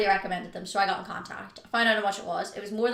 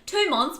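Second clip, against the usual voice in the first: "two months" said higher and louder; stress on two months; 7 semitones higher, 4 dB louder than usual. Real, not acted. Stressing to viewers the dog left only briefly. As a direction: punch "two months" as the key point. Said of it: "by the way, not forever".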